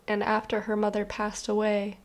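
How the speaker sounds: usual speaking voice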